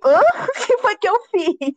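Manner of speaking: high-pitched, incredulous mock protest; startled protest. Grandchild answers scolding grandma, high startled mock protest. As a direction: act caught off guard, pitch leaping up.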